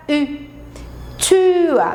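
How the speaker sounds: Tür with rounded U lips saying I; umlaut Ü. Shown as a demonstration. Teacher demonstrating the German Ü in Tür. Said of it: "Eu faço a boca como se eu fosse falar um U, mas na hora eu falo I".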